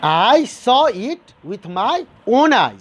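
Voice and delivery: slow, weighty "I saw" and "own eyes"; deliberate demonstration, leaning on "own eyes"; emphatic stress. Shown as a demonstration. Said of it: "So you are giving a stress".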